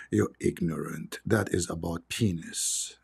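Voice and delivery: low, slow, huffy lizard voice; the lizard takes offence, drawn out; lightly voiced indignant lizard, low and slow; offended lizard. Lizard voiced in his story.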